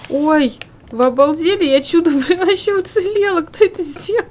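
high, loud outraged surprise; indignant surprise. Real, not acted. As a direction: protest in shock, half laughing. Nearly killed, protests at friends.

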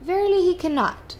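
high, loud knight's pronouncement; knightly declaration. A narrator's reading. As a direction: declare it grandly as a storybook knight. Knight's emphatic reply in story.

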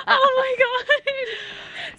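high, drawn-out squeal through laughter; helpless laughter. Real, not acted. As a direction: gasp it out slowly while laughing hard. Laughing after a startle downhill.

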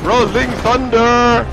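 slow, loud, high victory call; drawn-out triumphant shout. Real, not acted. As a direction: stretch it out like a battle cry.

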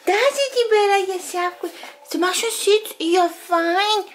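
high, bright commands; dog praise voice. Real, not acted. Training puppy.

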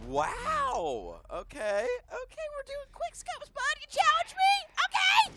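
high squeaky taunt. Real, not acted. Taunting during game.

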